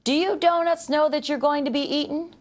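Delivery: calling across the shop; yelling. A narrator's reading. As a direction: call out to the donuts. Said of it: "The baker yelled".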